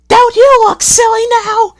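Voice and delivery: very high, loud jeering coach voice; mocking coaches. A narrator's reading. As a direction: taunt the engine. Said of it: "they chattered".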